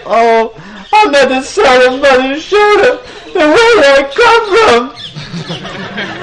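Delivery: high-pitched wailing child, hammed-up; mock bawling like an upset little boy; crying. Krishna to the teasing boys, hammed-up crying. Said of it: "he started crying".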